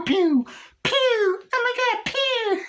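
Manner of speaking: very high, loud squealed pews; mimicked gunfire. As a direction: squeal gunshots with a panicked cry between. Imitating gunfire in the chase.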